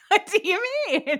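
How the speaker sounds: high, loud indignant squeak; mock outrage. Real, not acted. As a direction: act scandalised.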